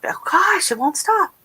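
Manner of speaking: tearful, can't stop crying; real crying. Real, not acted. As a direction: voice cracking with real tears. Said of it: "I wish I put on waterproof mascara".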